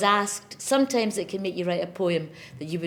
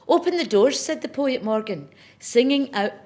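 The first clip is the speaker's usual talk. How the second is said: ringing, raised opening of a recited poem; poem recital; 6 semitones higher, 4 dB louder than usual. Acted. Poet opening her poem for Parliament, ringing out. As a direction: declaim it like a public ode. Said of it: "It's called Open".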